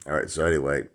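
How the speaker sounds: usual speaking voice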